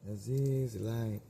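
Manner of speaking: lightly high put-on sheik voice. Repeating the sheik bit to himself, lightly high. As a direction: toss off the sheik line again.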